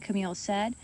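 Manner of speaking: plain reading voice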